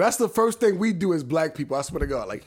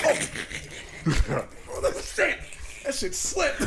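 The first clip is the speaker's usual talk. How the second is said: breathless laughter; 8 semitones higher, 4 dB quieter, much slower than usual. Real, not acted. Laughing at his own slip.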